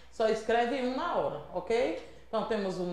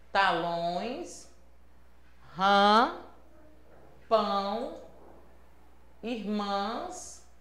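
slow, drawn-out reading of each word; teacher reading a word list slowly, one word at a time; slow word dictation; 6 dB quieter, much slower than usual. Shown as a demonstration.

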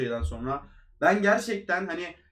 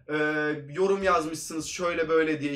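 usual speaking voice

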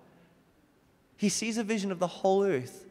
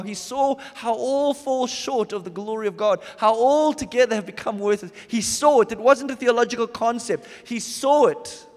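louder, higher, insistent preaching; fervent preaching; 5 semitones higher, 6 dB louder than usual. Acted. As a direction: drive it home with rising force. Preaching rises to full force.

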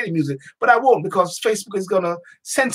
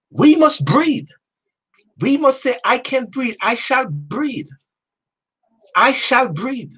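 higher-pitched, drawn-out proclamation; rallying declaration; 5 semitones higher, slower than usual. Real, not acted. Rallying declaration against "I can't breathe.

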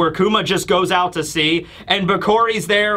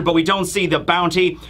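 usual speaking voice

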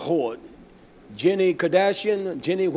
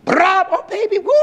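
triumphant whoop; 7 dB louder than usual. Acted. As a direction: celebrate with a long screaming woo. Acts man's whooping triumph.

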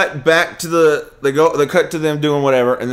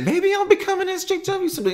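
much higher, softer mock voice; 6 dB quieter than usual. Mocking the droid's wish, high and soft. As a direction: squeak it out like a naive droid.

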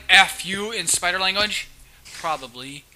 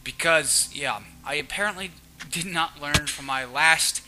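normal talking voice

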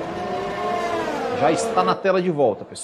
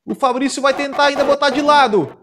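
soaring finish-line shout, much higher; excited finish call; 8 semitones higher, 3 dB louder, slower than usual. Real, not acted. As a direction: call the win at full voice. Race finish, live commentary.

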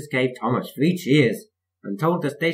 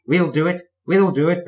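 panting bravely; 7 semitones higher, 6 dB louder than usual. A narrator's reading. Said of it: "panted Thomas bravely".

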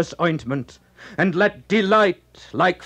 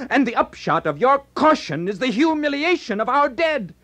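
much higher, fast, accusing; bitter reproach; 9 semitones higher, faster than usual. Acted. Glaucus rebukes Hector for his caution, high and fast. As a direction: shame Hector for abandoning the dead. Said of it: "While Glaucus asked".

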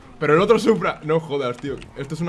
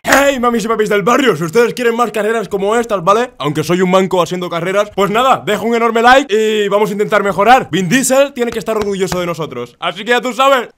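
loud, projected sign-off; YouTuber outro; 5 semitones higher, 10 dB louder than usual. Acted. Host asking viewers for likes, loud outro.

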